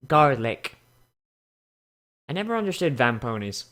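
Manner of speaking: ordinary talk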